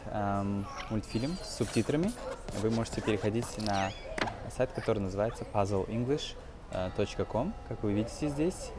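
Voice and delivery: normal talking voice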